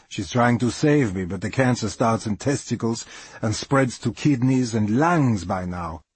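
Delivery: plain reading voice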